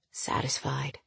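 breathy whisper, smug. Acted. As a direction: hiss it softly, challenging. Possessive lover ends speech.

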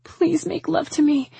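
high, pleading voice; tearful plea. A narrator's reading. Woman begging the man to hold her, pleading. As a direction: beg him, voice pitched high. Desperate plea continues after crying.

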